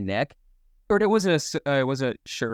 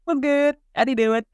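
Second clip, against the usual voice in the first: excited greeting; 12 semitones higher, 4 dB louder than usual. Real, not acted. Greeting a child.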